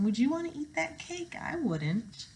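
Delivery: ordinary delivery, nothing marked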